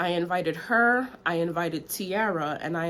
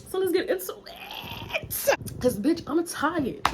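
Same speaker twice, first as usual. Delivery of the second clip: exasperated; 11 semitones higher than usual. Real, not acted. Exasperated, high, slow.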